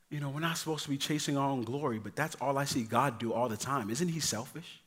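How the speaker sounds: put-on soft, quick child's question; a softly voiced little girl asking; hushed child question. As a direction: ask it quietly like a curious kid.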